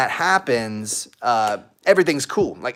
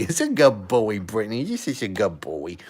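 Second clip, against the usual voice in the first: pet praise; 3 semitones lower than usual. Real, not acted. Praising his husky Brittany.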